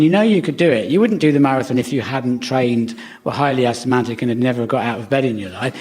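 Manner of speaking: ordinary talk, nothing marked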